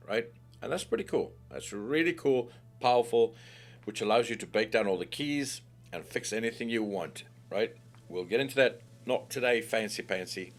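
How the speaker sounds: usual speaking voice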